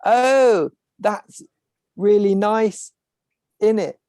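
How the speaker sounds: exaggerated posh English accent with a Cockney tag; posh English accent, hammed up. Shown as a demonstration. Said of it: "but with a posh accent".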